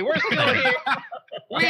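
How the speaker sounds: ordinary talk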